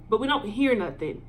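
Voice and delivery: usual speaking voice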